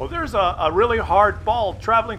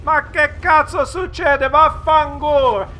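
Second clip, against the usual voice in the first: Italian; 5 semitones higher, 5 dB louder, slower than usual. Retold or imitated. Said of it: "He's saying".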